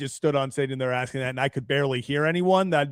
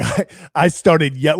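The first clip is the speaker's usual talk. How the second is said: louder, slow mock yell into the mic; imitating a yell; 5 semitones higher, 8 dB louder, slower than usual. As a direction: ham up a slow stage shout. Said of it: "he's yelling".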